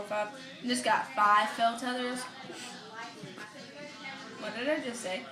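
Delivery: usual speaking voice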